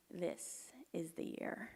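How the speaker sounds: slow hushed whisper to herself; whispered thought. Retold or imitated. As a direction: breathe it like a private wish. Secret hopeful thought to herself.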